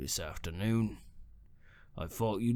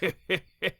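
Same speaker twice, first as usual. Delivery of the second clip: scornful laugh; 9 semitones higher, 4 dB louder than usual. Acted. Caretaker mocks the claim.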